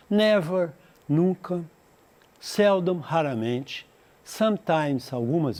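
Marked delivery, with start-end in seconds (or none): none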